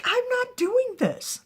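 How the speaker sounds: high, loud, drawn-out protest; exasperated denial. Acted. Accused man protests in story.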